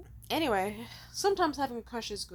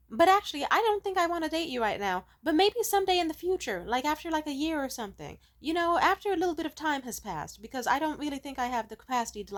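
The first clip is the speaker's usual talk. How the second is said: mock gentle letdown; 8 semitones higher, 4 dB louder than usual. Quoting his rejection text.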